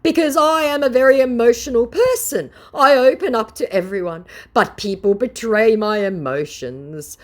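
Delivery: high, loud whiny voice of a scammer; mock scammer voice. Vlogger mocking a scammer's plea, high and loud. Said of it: "the scammer goes".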